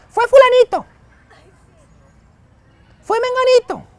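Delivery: high-pitched mock child excuse; imitating whiny kids. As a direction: squeak it like a kid passing the blame. Kids blaming others to father.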